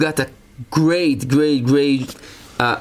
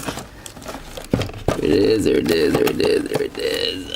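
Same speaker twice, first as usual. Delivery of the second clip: low whisper; 9 semitones lower, 3 dB quieter than usual. Pretend. Repeated in a low half-whisper.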